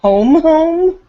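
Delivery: high, loud questioning disbelief; acted disbelief, pitch leaping up; incredulous echo. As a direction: echo it back as a startled question. Daughter questions mother's answer in scene.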